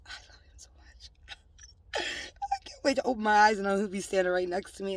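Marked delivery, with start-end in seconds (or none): 0.1-1.1 whisper. Real, not acted. Whispered confession.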